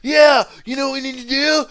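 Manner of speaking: party-dude Wayne impression. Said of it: "you sounded just like Wayne".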